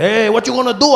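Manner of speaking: high, loud vendor voice; taco guy. Retold or imitated. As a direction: shrug like a vendor. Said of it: "He said".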